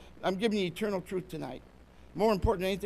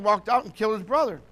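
ordinary delivery

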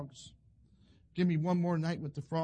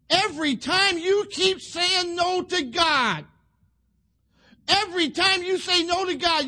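high, loud preacher's warning to the congregation; full-force preaching; 9 semitones higher, 7 dB louder than usual. Acted. Preacher warns young people against saying no to God, impassioned. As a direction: drive each phrase home, pitched high and loud.

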